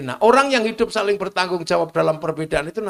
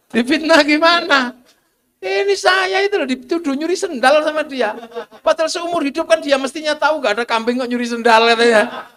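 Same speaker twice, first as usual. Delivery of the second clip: high, loud, indignant complaint; indignant retelling; 6 semitones higher, 5 dB louder than usual. Pretend. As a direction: protest the slander loudly. Goat's accusation retold excitedly.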